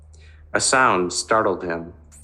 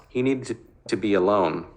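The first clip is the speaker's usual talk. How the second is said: usual speaking voice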